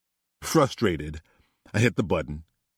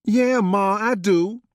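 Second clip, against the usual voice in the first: put-on much higher, louder young daughter's voice; daughter confessing; 14 semitones higher, 5 dB louder, slower than usual. Paris admits to her mother she knows, high and bright. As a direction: own up with a cheeky lift. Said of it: "Paris confessed".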